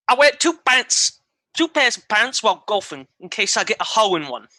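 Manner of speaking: hammed-up Cockney accent. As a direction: read it out as a Cockney. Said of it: "saying this in a Cockney accent".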